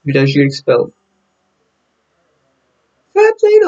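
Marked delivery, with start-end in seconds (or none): 3.1-3.7 mock voice. Mocking a cartoon voice.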